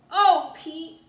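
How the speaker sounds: a big, loud, high "Oh, Pete"; excited exclamation. Acted. Callie to Pete, calling out, loud and high with excitement. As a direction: burst out eagerly at a friend.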